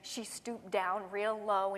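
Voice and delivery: usual speaking voice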